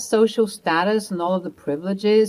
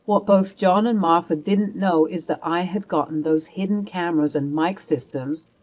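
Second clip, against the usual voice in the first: ordinary delivery, nothing marked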